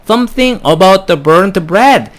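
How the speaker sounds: usual speaking voice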